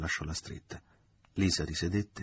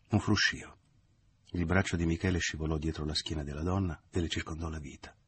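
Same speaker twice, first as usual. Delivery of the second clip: ordinary delivery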